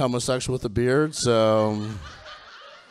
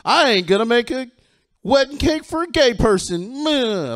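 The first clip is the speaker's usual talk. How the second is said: higher, louder hammed-up bigot; 9 semitones higher, 4 dB louder than usual. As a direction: play a huffy small-town baker. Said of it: "a straight person's like".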